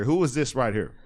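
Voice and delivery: normal talking voice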